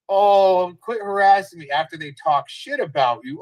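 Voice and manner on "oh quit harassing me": higher, louder sarcastic whine; mocking whiny imitation. Mocking rival streamer Lady Scorpio who complains about being harassed, higher, louder whine. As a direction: mimic her playing the victim. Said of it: "Lady, Lady Scorpio".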